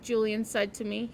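ordinary delivery, nothing marked

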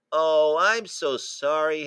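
a touch of heartfelt sorrow, drawn out; sorrowful sympathy. A narrator's reading. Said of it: "Harriet said, touching the fallen giant".

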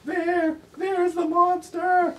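very high, loud drawn-out cry; panicked shout. Acted. Reader voices character pointing out the monster, panicked. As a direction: shout it in hammed-up terror.

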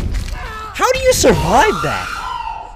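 high, slow, drawn-out how do you survive that; high incredulous wail; amazed disbelief. Real, not acted. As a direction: stretch it out in stunned disbelief. Amazed at a death.

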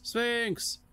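very high, pleading cry; desperate shout. Acted. Second, more desperate call.

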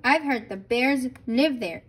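usual speaking voice

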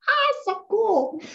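high, drawn-out delight. Shown as a demonstration. Acting delighted about reading.

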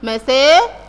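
"مساء" with a long middle and an -in ending; teacher sounding out the kasr tanween on "مساء"; tanween demonstration. Shown as a demonstration. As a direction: say "مساء" as masaa-in, stretched and rising. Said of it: "كمان الكلمة اللي جنبيها".

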